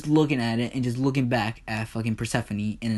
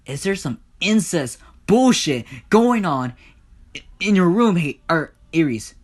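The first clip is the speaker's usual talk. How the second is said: louder, higher outraged demand; angry confrontation; 6 semitones higher, 7 dB louder than usual. Acted. Hades confronts Ares over the scandal, outraged. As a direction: confront him with loud, indignant disbelief.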